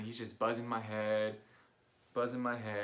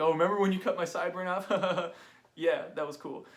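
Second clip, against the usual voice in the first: 1 joking tease; 7 semitones higher, 6 dB louder than usual. Retold or imitated. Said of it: "brought that up as a joke".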